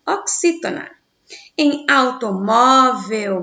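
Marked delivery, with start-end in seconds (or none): 1.9-3.4 slow, loud "automóvel", "mó" strongest and drawn out; stress on MÓ. Shown as a demonstration. Teacher shows a paroxytone, "automóvel" slowly with "mó" stressed. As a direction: stretch and punch the "mó" in "automóvel". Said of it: "mó é a segunda sílaba mais forte".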